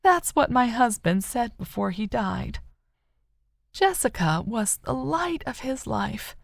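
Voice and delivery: a mother who refuses to let go; grieving mother. A narrator's reading. Rose's voice in the story.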